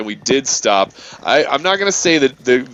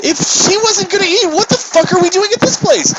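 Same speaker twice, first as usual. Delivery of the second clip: exasperated rant. Real, not acted. Ranting to a friend about the pointless stop, high exasperation. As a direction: throw up your hands in disbelief.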